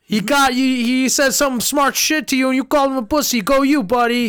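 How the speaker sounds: loud, high hammed-up Boston bro hype; a loud Southie bro egging his pal on in a Boston accent. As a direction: cheer your buddy on in a Boston accent. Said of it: "I can never do, like, a accent".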